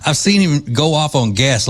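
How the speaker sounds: ordinary delivery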